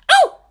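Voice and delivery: sudden high startled yelp. A narrator's reading. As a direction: yelp in surprise, very high and loud. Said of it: "Maya yelped".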